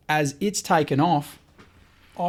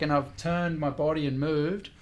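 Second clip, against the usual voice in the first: ordinary talk, nothing marked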